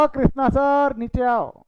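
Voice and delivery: high, loud call from downstairs. Retold or imitated. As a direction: shout up to him from the street. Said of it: "मर्जिश आवाज़ मार रहा". (नीचे से ज़ोर से आवाज़ लगाना)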